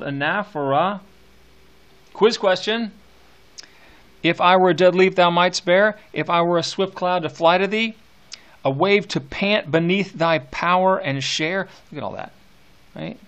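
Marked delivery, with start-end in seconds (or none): 4.2-11.7 loud, rolling recitation of verse; poem reading. Acted. Teacher reciting Shelley to his class, full-voiced and rolling. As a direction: declaim it to the class like a poet. Teacher reads the ode aloud.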